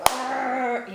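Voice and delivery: imitated machine noise. Patient expecting the scanner to squash her, imitated machine noise. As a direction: voice a grinding machine sound. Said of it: "it was just gonna be like".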